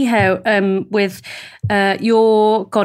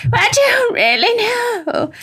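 very high, slow, drawn-out character voice; old party-piece impression; 12 semitones higher, slower than usual. Repeating the old impression for friends, high drawn-out character voice. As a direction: stretch it out high and plaintive, as a caricature. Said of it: "you won't know who that is".

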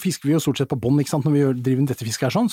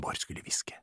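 hushed, whispered end of a limerick; whispered punchline; 15 dB quieter than usual. Acted. Limerick punchline about having to whisper.

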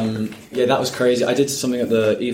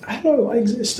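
much higher, squeaky shy hello; timid newcomer. Retold or imitated. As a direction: play the unknown nervous newbie, pitched way up. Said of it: "and I was like".

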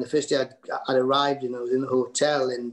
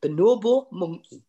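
put-on Geordie accent, a bit louder; 5 semitones higher, 4 dB louder than usual. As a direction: voice a Geordie saying it. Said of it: "when a Geordie goes".

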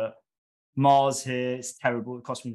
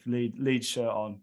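normal talking voice

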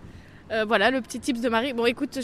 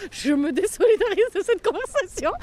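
prim distancing voice; 8 semitones higher than usual. Retold or imitated. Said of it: "elle a fait trois pas en arrière en mode".

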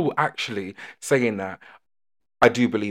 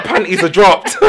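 giggling through a mock swoon line; laughing quote; 6 semitones higher, 8 dB louder than usual. Friend teasing about Irish charm, laughing. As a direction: laugh it out, higher and louder. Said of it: "Yeah, you're like".

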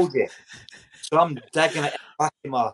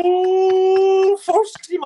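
high, loud mock cheer; excited cheer; 8 dB louder, much slower than usual. The squad cheers a player picked for the first team, high excited whoop. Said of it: "the cheer would go like that".

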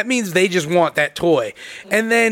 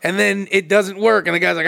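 normal talking voice

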